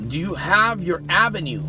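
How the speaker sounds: ordinary talk, nothing marked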